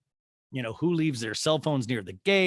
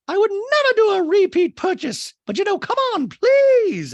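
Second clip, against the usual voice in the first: very high, loud, stretched-out mock drama; hammed-up shouted impersonation; Dorit; 10 dB louder than usual. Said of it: "And Dorit goes".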